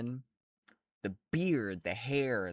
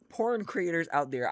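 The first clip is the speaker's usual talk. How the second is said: ordinary talk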